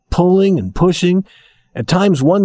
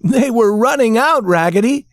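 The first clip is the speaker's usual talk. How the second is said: cheering shout; 9 semitones higher than usual. A narrator's reading. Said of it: "shouted all the other dolls".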